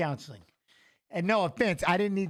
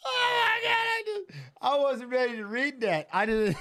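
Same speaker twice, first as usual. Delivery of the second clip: high-pitched helpless laughter through the words; can barely speak for laughing; real tears-of-laughter squeal; crying laughing; 6 semitones higher than usual. Real, not acted. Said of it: "I'm crying. I got tears in my eyes".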